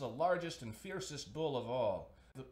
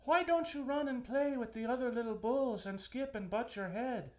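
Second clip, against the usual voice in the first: high, louder motherly coaxing voice; pitched-up mother asking her son to play; mother cow; 11 semitones higher, 4 dB louder than usual. Acted. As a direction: coax him like a worried mother cow. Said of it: "she would say".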